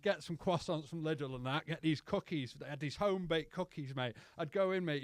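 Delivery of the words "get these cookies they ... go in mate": ordinary talk, nothing marked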